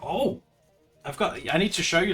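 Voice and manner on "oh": normal talking voice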